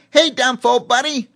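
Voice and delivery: high, loud squeaky chimpanzee; boastful chimp squeaking a greeting. A narrator's reading. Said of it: "Cheemy squeaked".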